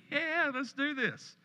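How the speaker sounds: high, slow eager yes; gleefully in on the plan; excited. Retold or imitated. Eager reply to friend's invitation.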